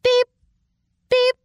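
short electronic-style beep, evenly repeated; radar beeps. Acted. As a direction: imitate a scanner beeping at steady intervals. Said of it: "Sound waves started all around".

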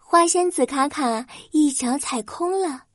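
plain reading voice

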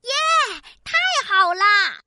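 loud happy cheer. Acted. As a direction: shout for joy. Cheering for new hat.

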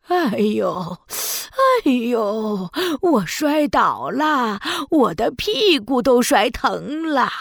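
put-on slow groaning old woman; old granny voice. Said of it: "海女巫变成了一位老奶奶". (假装摔倒的老奶奶声音)

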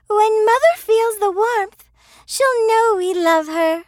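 put-on high tender girl voice; a daughter hoping mother feels loved; lightly voiced girl.

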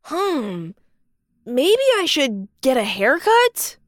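wondering to himself; pondering. Acted. Balloon pondering.

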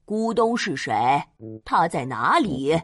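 lion stopping the animals to question them; lion's voice. Acted. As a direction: ask like the king of the forest.

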